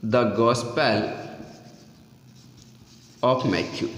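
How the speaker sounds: drawn-out words, long gaps between phrases; slow dictation. Real, not acted. Teacher dictating the Bible source to students, slow dictation. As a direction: read it out very slowly, piece by piece.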